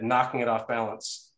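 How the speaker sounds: usual speaking voice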